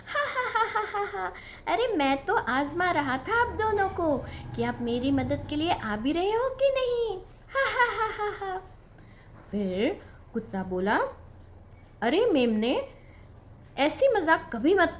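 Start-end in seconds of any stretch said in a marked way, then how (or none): 0.1-8.6 high mocking laughter; teasing laugh. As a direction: laugh at the friends for falling for it. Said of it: "मेमना जोर से हंसने लगा". (चिढ़ाती हुई ऊँची हँसी)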